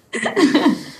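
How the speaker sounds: bright high burst of laughter; real laugh, high. Real, not acted. Laughing along with cohost at the joke. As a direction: let a real laugh ring out.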